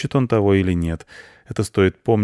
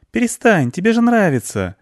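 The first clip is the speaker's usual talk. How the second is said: put-on very high, louder child voice; coaxing girl; 12 semitones higher, 4 dB louder than usual. Girl tells reluctant cat he likes it, high and coaxing. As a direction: coax in a high girl's voice.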